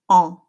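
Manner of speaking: en with the jaw dropped, open nasal vowel; French nasal an. Shown as a demonstration. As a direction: drop the jaw and say en through the nose. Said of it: "you just drop your jaw".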